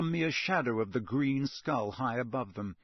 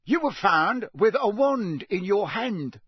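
louder, raised, slow barking; barked accusation; 8 semitones higher, 6 dB louder, slower than usual. A narrator's reading. Official accusing the elf, barking. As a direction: bark it at her like an accusation. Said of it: "barked Mister Diggory".

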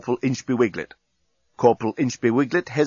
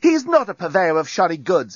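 higher, protesting outburst; indignant exclamation; 7 semitones higher, 4 dB louder than usual. A narrator's reading. Lucy defending the journalist, indignant exclamation. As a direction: leap to his defence. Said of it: "exclaimed Lucy".

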